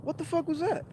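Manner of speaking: very high, soft, rising bewilderment again; mock-stunned high-pitched echo; high bewildered. Repeated bafflement.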